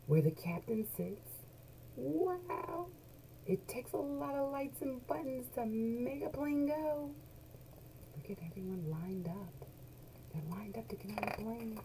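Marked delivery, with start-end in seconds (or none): 2.0-7.1 high, soft amazed voice; soft high-pitched wonder; awed wow. Acted. Awed at cockpit. 8.2-11.8 soft aside. Acted.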